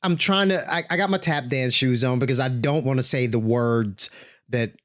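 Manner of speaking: ordinary talk, nothing marked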